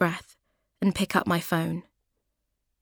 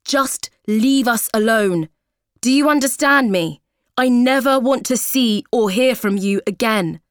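loud furious yell, higher and louder; shouting angrily; 4 semitones higher, 7 dB louder than usual. Acted. Ex-husband yelling at Rachel in a voicemail, furious. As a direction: rage down the phone at her. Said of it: "He's shouting angrily".

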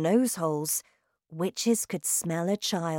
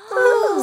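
witches gasp; 12 dB louder than usual. Acted.

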